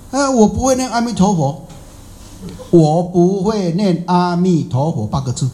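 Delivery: high, whiny protest from a poor beggar; a lightly voiced beggar pleading he can't do it; imitating the beggar. Beggar refusing the monk, in a mock whiny voice. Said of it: "他说我不会念啊".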